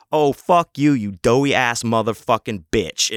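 loud, punchy cursing comeback; heated insult, hit hard; retold angry outburst. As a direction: re-enact blowing up at someone. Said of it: "I fucking went off on him".

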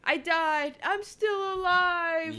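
high, drawn-out mock cry; mock dying character. Dying movie character who won't quit, mock wailing. Mocking a joke that drags on.

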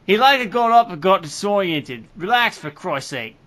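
hammed-up Australian accent; loud, overdone mock Australian; bad Australian accent. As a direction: read it in a broad, badly faked Australian accent. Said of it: "we do bad Australian accents".

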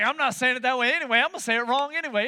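high, loud defiance; stubborn refusal. Retold or imitated. Listeners refusing to change how they say it, stubbornly. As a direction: raise pitch and volume, digging in.

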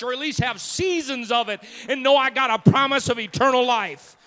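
loud, high preaching climax. Acted. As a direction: build the sermon to a shout. Preaching at full force.

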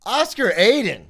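high, drawn-out guest announcement; announcer intro. Real, not acted. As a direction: announce the guest with a big rising flourish. Host announces the guest's name.

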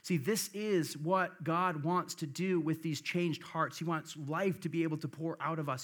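ordinary talk, nothing marked

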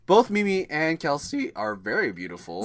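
voice cracking. Real, not acted. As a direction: voice breaks mid-sentence. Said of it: "twelve-year-old [name]. What was that?".

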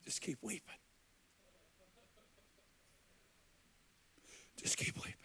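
near-whisper, very soft, repeated after long pauses; hushed retelling. Retold or imitated. Preacher retelling a prophet's comfort to two friends, hushed. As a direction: drop right down to a barely audible murmur. Retold prophet's tender parting words.

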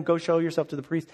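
ordinary talk, nothing marked

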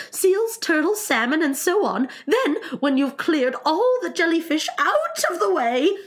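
high, eager creature voice; excited cry. Acted. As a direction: rattle off the steps high and excited. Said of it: "cried the Mock Turtle".

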